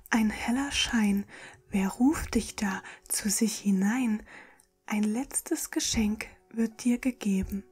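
ordinary talk, nothing marked

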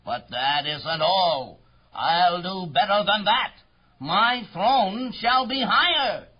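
boastful royal tirade; thundering royal voice. Acted. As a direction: lay down the law to a lowly subject. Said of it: "His royal voice thundered".

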